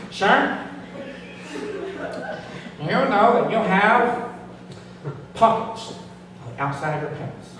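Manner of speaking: lightly voiced officious narc, high at first; campus informer. Student narc citing a pocket violation, officious mock voice. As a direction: imitate a rule-enforcer, halting between phrases. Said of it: "he sounded like this".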